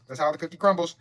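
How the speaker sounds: usual speaking voice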